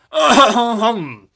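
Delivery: loud high ahem; polite cough. A narrator's reading. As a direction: clear your throat pointedly. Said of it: "The Fat Controller coughed".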